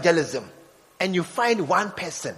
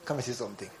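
quieter coaxing repeat; softer repeat; 9 semitones lower, 13 dB quieter, much faster than usual. Retold or imitated. Evangelist coaxing a stranger softly. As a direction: drop the voice on the repeat.

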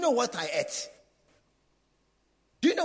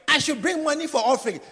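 higher, louder mocking shout; 6 semitones higher, 7 dB louder than usual. Member mimics the pastor's call for offering, shouting. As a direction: shout the pastor's demand back at him. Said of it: "When you say".

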